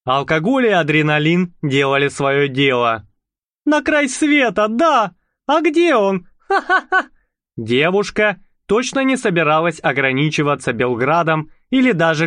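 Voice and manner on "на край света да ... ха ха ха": put-on high giggly tipsy girl; tipsy laughing. A narrator's reading. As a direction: laugh through the words, tipsy.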